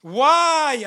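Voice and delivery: a high, loud cry of disbelief; shouted why. Acted. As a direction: fling it out at the crowd in protest. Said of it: "I shouted".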